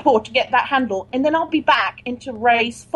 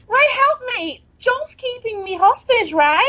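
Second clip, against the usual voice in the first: high, eager pleading voice; cling to the caller, urgently; desperate assistant; 10 semitones higher than usual. Acted. Hostage assistant answering Ray on the phone, desperate.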